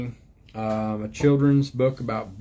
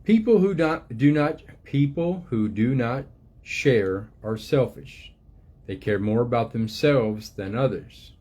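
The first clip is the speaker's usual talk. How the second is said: gentle storybook reading voice, unhurried; reading to children. Acted. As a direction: read it like a picture book to little ones. Reads book aloud to children.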